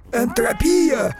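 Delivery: high, slow, loud wail; mournful call. Acted. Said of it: "скорбным голосом позвал".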